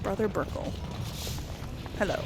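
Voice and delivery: ordinary delivery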